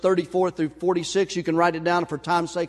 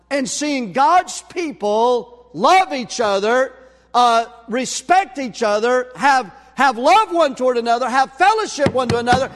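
louder, stretched sermon cadence; rising preaching; 5 semitones higher, 5 dB louder than usual. Acted. Pastor urging the church to love each other, building preaching. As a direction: build them up toward conviction.

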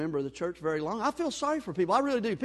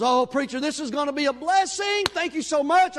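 high, loud, gushing gratitude; gushing thanks; 9 semitones higher, 7 dB louder than usual. Retold or imitated. Woman thanks the pastor for his help, gushing. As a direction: gush like the help is already here.

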